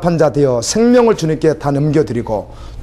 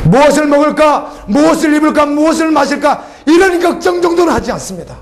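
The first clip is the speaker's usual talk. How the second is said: high, rising preacher's call; impassioned sermon delivery; fervent preaching; 11 semitones higher than usual. Acted. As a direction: fling the questions out to the pews. Preacher raising the worry questions.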